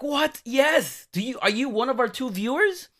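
high, loud burst of amazement; excited disbelief. Retold or imitated. Host to his date on meeting a listener, excited disbelief.

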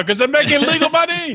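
high-pitched put-on impression; crowing in a hammed-up character voice; Jehoon. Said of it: "talking like Jehoon now".

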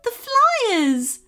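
high, loud happy cry; excited cry. Acted. Bev tells the kids the flyers worked, crying out happily. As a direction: cry it out with joy. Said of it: "cried Bev".

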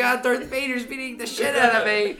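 high, loud hammed-up victim scream; comic cry for help; mock screaming. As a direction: scream like a panicked victim, played for laughs. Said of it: "The guy's just screaming".

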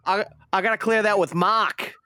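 light Boston accent imitation; mock the accountant's Boston accent; a hint of a Boston accountant. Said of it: "quite the, uh, Boston accent".

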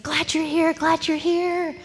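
high, loud chorus of welcome; so happy. Retold or imitated. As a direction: imitate an overjoyed welcome. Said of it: "everybody was so happy".

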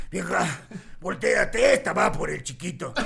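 deep loud imitation of the father; dad's voice. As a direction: growl it deep like the dad. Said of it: "Y de repente mi papá".

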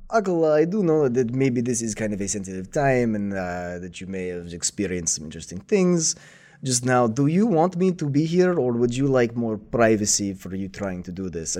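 gentle lightly voiced old master; a hint of a kindly old master; Master Oogway voice. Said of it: "he says".

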